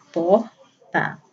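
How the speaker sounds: "porta" broken as por, pause, ta; one syllable of "porta" at a time, clear gap between. Shown as a demonstration. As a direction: say "porta" in two separate pulses of voice. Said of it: "cada impulso de voz".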